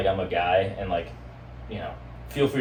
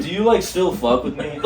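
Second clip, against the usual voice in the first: laughing; 8 semitones higher than usual. Retold or imitated. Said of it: "It's like".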